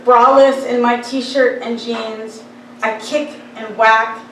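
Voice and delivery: slow, emphatic poetry recital; measured verse with pauses between images; poem reading. Acted. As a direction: recite deliberately, weighting each word. Reads own poem aloud.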